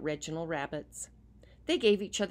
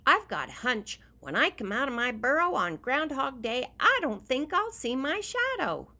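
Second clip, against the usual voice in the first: high, loud happy groundhog; cheerful groundhog voice; 8 semitones higher, 7 dB louder than usual. Acted. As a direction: announce the hunch brightly to friends. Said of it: "he said".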